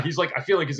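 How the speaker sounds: ordinary talk, nothing marked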